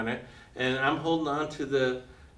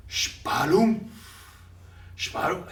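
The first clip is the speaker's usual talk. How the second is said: mimicking a heavy splash from the fog, rising; splash noise; 4 semitones higher, 4 dB louder, slower than usual. Narrator imitating the distant splashes of shark jaws. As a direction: spit out a wet splashing sound. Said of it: "huge splashes".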